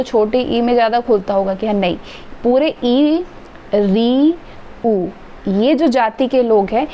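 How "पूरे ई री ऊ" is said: ई, री and ऊ drawn out one by one with the mouth barely open; vowel demonstration. Shown as a demonstration. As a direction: hold each vowel, ई, री, ऊ, mouth nearly closed. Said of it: "इनके बोलने के समय हमारा मुख बहुत कम खुलता है". (मुख लगभग बंद रखकर ई, री, ऊ का अलग-अलग उच्चारण)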